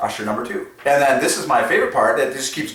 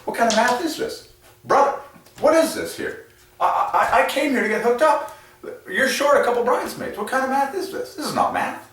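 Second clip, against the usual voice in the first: high, mock-indignant wedding guest; mock outraged guy; 11 semitones higher than usual. Groomsman complaining to the couple, high mock outrage. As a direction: squeak it out like a baffled groomsman. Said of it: "They're like".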